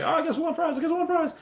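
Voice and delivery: very high, anxious mock squeak; imitated nervousness. Flustered friend ordering fries by phone, high mock-nervous voice. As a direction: squeak it like a rattled pushover. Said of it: "like, really nervous".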